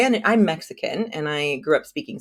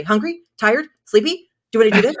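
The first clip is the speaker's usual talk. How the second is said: eager, higher-pitched prompting questions; helpful guessing; 6 semitones higher, 6 dB louder, faster than usual. Retold or imitated. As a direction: offer guesses, each a quick rising question. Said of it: "insert what they think you're feeling".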